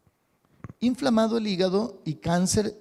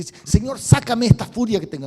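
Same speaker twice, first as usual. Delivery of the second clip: louder, higher plea to be rid of fury; pleading angry prayer; 4 semitones higher, 7 dB louder than usual. Pretend. Preacher acting an angry believer, pleading loudly. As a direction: beg God loudly to take the rage away.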